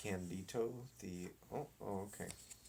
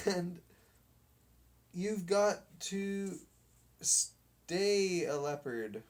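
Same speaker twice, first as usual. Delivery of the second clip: higher, slow commanding; ordered; 7 semitones higher, 4 dB louder, slower than usual. Acted. Captain orders the dog to stay leopard, slow and bossy. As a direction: spell out the order slowly. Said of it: "ordered the captain".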